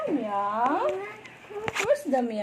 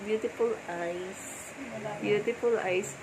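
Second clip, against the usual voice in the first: soft, slow sleepy coaxing; soothing lull; 5 dB quieter than usual. Real, not acted. Parent coaxing the baby to sleep, soft and slow.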